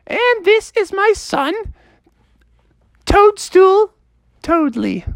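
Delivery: squeaky, slow, very high lady toad voice; very high mother toad. Acted. Mrs Toadley greeting the new frog, squeaky and very high. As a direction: go way up top like a prim mother toad.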